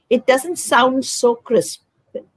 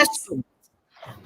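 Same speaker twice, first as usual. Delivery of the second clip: whisper; 3 semitones higher, 10 dB quieter, slower than usual. Real, not acted.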